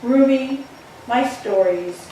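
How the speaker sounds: ordinary talk, nothing marked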